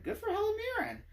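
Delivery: high, fast and delighted; gushing excitement. Retold or imitated. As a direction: squeal it out in admiration. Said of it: "I was like".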